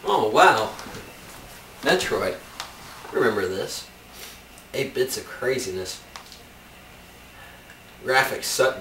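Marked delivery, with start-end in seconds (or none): none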